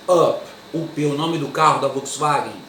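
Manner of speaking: normal talking voice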